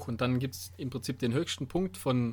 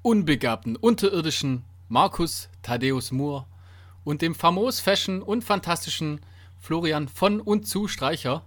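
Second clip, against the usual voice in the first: slow, formal announcer welcome; podcast host intro; 4 dB louder than usual. Acted. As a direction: announce it like a show opener. Hosts the show intro.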